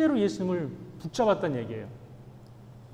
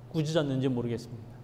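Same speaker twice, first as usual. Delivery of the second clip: ordinary delivery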